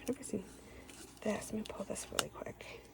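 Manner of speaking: quick hushed whisper to herself; whispered aside. Real, not acted. Crafter pulling out trim, whispering an aside. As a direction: mutter it under your breath.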